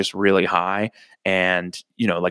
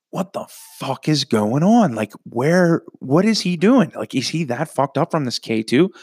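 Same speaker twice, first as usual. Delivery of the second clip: retold panic; 6 semitones higher than usual. Panicked retold questions at party.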